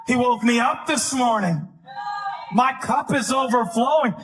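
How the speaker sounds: emphatic, rising pulpit fervor; rousing preaching. Real, not acted. Preacher asks flock where they came from, rousing preaching. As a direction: testify and stir the congregation.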